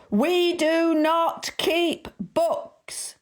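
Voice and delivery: high, very slow bawl. A narrator's reading. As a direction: spell out each word to a deaf customer. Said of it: "bawled the assistant at the top of his voice".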